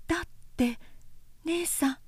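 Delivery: hushed and high, unbelieving; young girl Obun. Acted. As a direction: speak as the puzzled younger sister. Obun's young voice.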